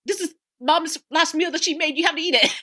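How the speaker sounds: hammed-up furious father, shouted high; angry husband. Blustery businessman snapping at his crying kids, mock shout.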